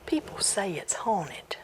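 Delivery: hushed, secretive whisper; spooky whisper. Acted. Old man tells boy of the haunted house, whispering. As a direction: lean in and whisper the rumor. Teller lowers voice about haunting.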